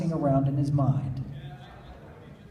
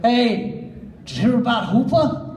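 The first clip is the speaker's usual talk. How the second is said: excited call; 9 semitones higher, 5 dB louder than usual. Acted. Calling out to wake someone.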